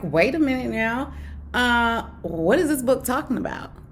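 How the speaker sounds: a hint of retold confusion while reading the book; baffled. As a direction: sound totally lost and puzzled. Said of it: "I was like".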